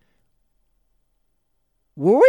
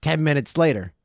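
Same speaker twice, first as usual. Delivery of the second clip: usual speaking voice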